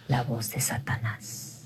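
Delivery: slow unvoiced whisper. Shown as a demonstration. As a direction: hush it like a dark secret. Nombra la voz de Satanás. (susurro lento y ominoso)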